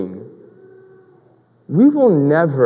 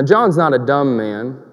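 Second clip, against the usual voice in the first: ordinary talk, nothing marked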